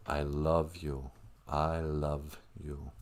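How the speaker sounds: soft, low, drawn-out declaration; tender. Shown as a demonstration. Showing how English says love, tender and slow. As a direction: say it slowly and quietly.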